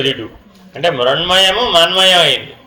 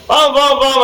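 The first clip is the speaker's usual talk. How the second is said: retold shout; 8 semitones higher than usual. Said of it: "గట్టిగా అరిచాడు".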